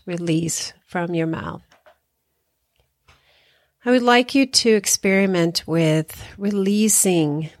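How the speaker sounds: unhurried meditation guide with long pauses; guided meditation. Acted. Hypnotherapist guiding listeners through body relaxation, calm guided meditation. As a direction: ease them through breathing and relaxing, leaving space after each phrase.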